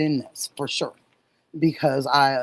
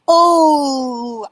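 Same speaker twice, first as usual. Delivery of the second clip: excited; 9 semitones higher, 8 dB louder than usual. Real, not acted. Excited exclamation.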